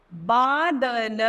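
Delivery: बादल drawn out slowly, syllable by syllable; slow syllable reading. Shown as a demonstration. Teacher modelling बादल slowly. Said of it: "इन उदाहरण को पढ़ते हैं हम".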